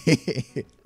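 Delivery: quick words breaking into giggles; amused, tipping into real laughter. Real, not acted. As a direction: say it fast, then giggle helplessly. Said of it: "Why am I laughing like a baby".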